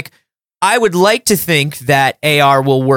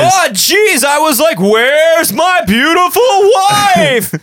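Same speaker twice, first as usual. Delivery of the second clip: high, slow hacky comic yell; nineties stand-up comic; 13 semitones higher, slower than usual. Retold or imitated. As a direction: bellow the hack punchline. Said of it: "nineties stand-up".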